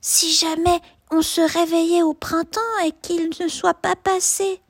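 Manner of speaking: put-on high, childlike cub voice; worried little cub; little bear voice. A narrator's reading. Little bear cub asks mother.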